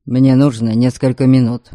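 low, curt order; commanding. A narrator's reading. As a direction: give a calm low command. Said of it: "приказал мне Норберг".

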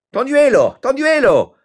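very high, loud summons; calling out. A narrator's reading. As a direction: holler for the servant in another room. Mayor calls his servant.

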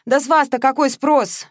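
high, loud disdain; wincing scorn. A narrator's reading. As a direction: dismiss her with a grimace. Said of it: "поморщилась Мария". (брезгливо, высоко и громко)

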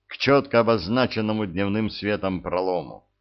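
ordinary delivery, nothing marked

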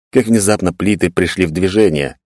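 plain reading voice

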